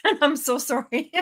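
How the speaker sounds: apologising through a laugh; laughing apology. Real, not acted. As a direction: laugh while saying sorry. Apologising for her breaking voice.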